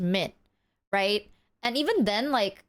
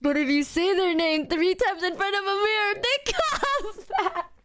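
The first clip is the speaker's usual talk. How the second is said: mock ghost story; 4 semitones higher than usual. Warning chat about the mirror legend, laughing. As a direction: tell it like a campfire tale, cracking up.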